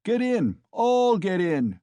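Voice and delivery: loud, high, drawn-out shout; crying out. Acted. Mikolka urging the crowd in, loud cry. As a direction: roar it at the crowd. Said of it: "cried Mikolka".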